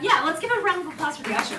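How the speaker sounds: high, loud, quick rally to applaud; rally the room, fast and loud; rousing applause call. Real, not acted. Host calling for applause for the ushers, rousing.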